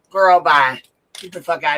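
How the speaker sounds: loud sassy brush-off; dismissive. Real, not acted. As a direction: wave them off loudly. Dismissing a rival loudly.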